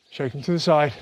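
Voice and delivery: usual speaking voice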